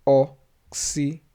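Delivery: Oxítonas in slow, separated syllables. Shown as a demonstration. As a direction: say Oxítonas as o, xí, to, nas. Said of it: "estamos falando da sílaba mais forte".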